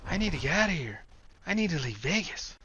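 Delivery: Will's voice. Acted. Will voiced higher.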